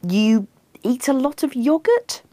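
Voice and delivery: high, tentative rising guess; desperate stab at an answer, as a question; despairing. A narrator's reading. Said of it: "Pete, despairingly, after a full minute".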